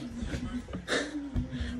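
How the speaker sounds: short breathy laugh, high and soft. Real, not acted. Joking about the dogs from Mexico, quiet laugh. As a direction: let a quiet laugh slip out.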